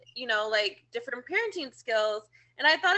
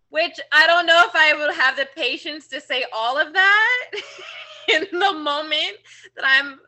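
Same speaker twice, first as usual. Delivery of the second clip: words breaking into laughter, pitched up and louder; laughing; 4 semitones higher, 6 dB louder than usual. Real, not acted. Mom laughs about her patience running out, louder and laughing. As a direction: laugh through the complaint about whining. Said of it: "laughing while talking".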